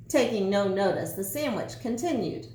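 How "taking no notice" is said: ordinary delivery, nothing marked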